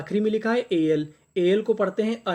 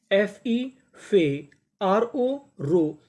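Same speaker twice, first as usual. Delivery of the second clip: slow spelling out; much slower than usual. Shown as a demonstration. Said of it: "teacher spells code syllables".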